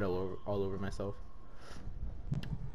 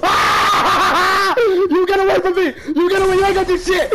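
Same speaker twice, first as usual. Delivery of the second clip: high, loud laughing shriek; laughing panic; 12 semitones higher, 5 dB louder, slower than usual. Real, not acted. Player running from the hunter, shrieking with laughter. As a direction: screech through laughter as you flee.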